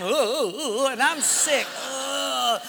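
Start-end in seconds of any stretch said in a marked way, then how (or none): 0.0-0.9 hooting fake laugh in a rough voice; imitating the crusty captain's hoots; mock laughing. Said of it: "and he's laughing". 1.7-2.6 hooting fake laugh in a rough voice; imitating the crusty captain's hoots; mock laughing. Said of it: "and he's laughing".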